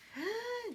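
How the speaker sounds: high, shocked Grover gasp; dramatic gasp. Acted. Grover gasping as the page turns, high shock. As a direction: gasp as if betrayed. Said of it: "He's very dramatic".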